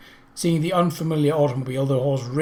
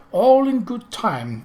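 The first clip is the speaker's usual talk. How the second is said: jovial; 8 semitones higher, 5 dB louder, slower than usual. A narrator's reading. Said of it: "the prince said jovially".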